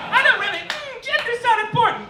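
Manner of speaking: high, breezy mock-celebrity voice; lilting mock pop-star voice. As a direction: airily mimic a pop star brushing it off. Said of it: "it is in [name]".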